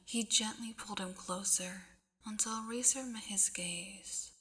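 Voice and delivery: hushed, slow storybook whisper; whispered narration. A narrator's reading. Reader whispering a dream sequence to the listener, hushed. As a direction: breathe the dreamy scene out softly.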